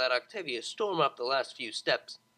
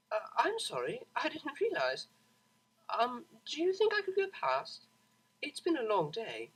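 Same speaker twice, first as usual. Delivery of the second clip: a hint of a polite, tired mare, higher; lightly voiced weary cellist; 8 semitones higher than usual. Octavia politely asking the Pegasus to let her by, weary. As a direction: awkwardly ask to pass, worn out.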